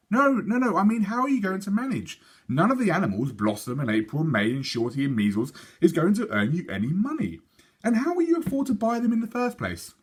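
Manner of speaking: a hint of a frustrated father; exasperated father. Father questioning Sophie's plan, mildly exasperated.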